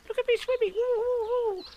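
very high-pitched, gleeful squeal; tiny high voice whooping proudly; proud, bubbling little creature. A narrator's reading. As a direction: bubble over with pride, way up high. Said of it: "still bubbling proudly".

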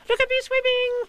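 put-on very high, loud baby squeak; little kangaroo showing off swimming; squeaky Roo. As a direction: squeak it with glee. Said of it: "squeaked Roo".

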